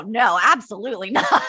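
giggling through a firm no; laughing refusal. Retold or imitated. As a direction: laugh while saying absolutely not. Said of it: "And I was like".

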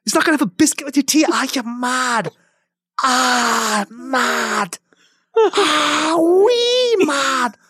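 very high, crying Irish banshee wail; hammed-up banshee crying over the biscuit. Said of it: "it's the Banshee".

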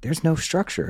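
incredulous exclamation; disbelief. Retold or imitated. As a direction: react as if it can't be true. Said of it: "I'm like".

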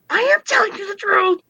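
high-pitched exasperated insistence; infuriated insistence. Retold or imitated. Patient to doctor who won't believe her, infuriated insistence. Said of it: "it was very infuriating".

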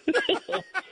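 high, open burst of laughter; real amazed laugh; hearty laugh. Real, not acted. Host reacts to insane story.

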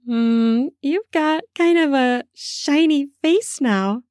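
put-on high teasing girlish tone; playful woman voice. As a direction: tease him lightly, up high. Heroine voice, higher, playful.

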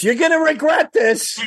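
high, far-off indignant snarl; indignant threat. Retold or imitated. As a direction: shout back while being dragged off. Fans pushed away by bouncers.

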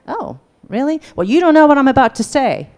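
sarcastic, rising to a loud snap; a defensive jab at the partner; defensive retort. Pretend. Acted defensive reply to partner.

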